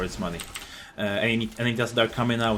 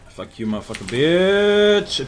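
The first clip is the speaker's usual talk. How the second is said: louder, higher, slow drawn-out last word; gloating taunt; 5 semitones higher, 5 dB louder, slower than usual. Real, not acted. Gamer taunting an enemy player, gloating and drawn out. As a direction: stretch the final insult into a loud taunt.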